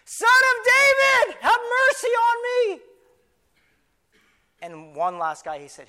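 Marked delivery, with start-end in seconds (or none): 0.1-2.8 drawn-out, high, very loud cry; all-out pleading shout; top-of-the-lungs yell. Acted. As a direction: scream for mercy over the crowd. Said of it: "I yelled at the top of my lungs".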